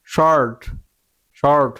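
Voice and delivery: শর্ট with a round-mouthed "or". Shown as a demonstration. Teacher showing how the spelled word is pronounced, rounded শর্ট. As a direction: round the lips on each শর্ট. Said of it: "মুখটা গুল হবে তখন".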